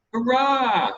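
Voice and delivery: loud, high joyful shout; shouted hurrah. A narrator's reading. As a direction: shout it out with delight. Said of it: "shouted Roger".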